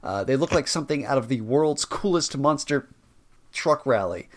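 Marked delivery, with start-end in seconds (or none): none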